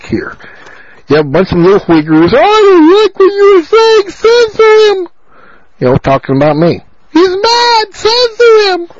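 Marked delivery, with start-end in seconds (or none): 2.4-5.1 much higher, whiny caricature of a critic; mocking whiny voice. Mocking critics, a much higher prissy whine. 7.1-8.9 much higher caricature with a whimpering cry; mocking crybaby voice. Mocking critics who cry censor him, a much higher whimper.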